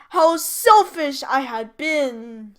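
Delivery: slow, loud remorseful cry; slow and loud, the giant's big regret. A narrator's reading. The giant crying out his regret, slow and loud. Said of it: "he cried".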